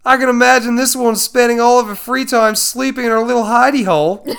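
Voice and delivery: loud, high mock Aussie wildlife narrator; Australian accent. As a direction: ham up an Australian nature-show voice. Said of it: "Laughs in Australian".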